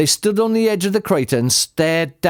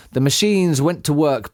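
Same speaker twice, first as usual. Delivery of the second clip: plain reading voice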